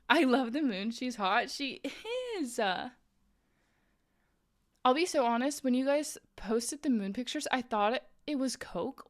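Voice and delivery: usual speaking voice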